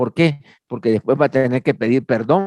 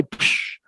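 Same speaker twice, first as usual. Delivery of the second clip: unvoiced "psh" like fizzing water; quick hiss of a tablet hitting water; imitated fizz; 6 dB quieter than usual. (siseo de efervescencia)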